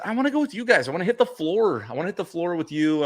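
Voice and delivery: high-pitched, hammed-up begging; playfully imitating his own pleading; retold eager plea. Retelling own eager plea to friends.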